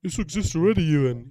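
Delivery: high, loud snooty heckler voice; mock know-it-all commenter. Mocking the commenter who tells Ewan it already exists, in a high, loud snooty voice.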